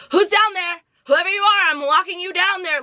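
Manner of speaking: high-pitched mock horror-movie voice; imitated horror-film heroine. Reviewer mocking woman in the first Conjuring, high-pitched call. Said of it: "she was sitting there talking about".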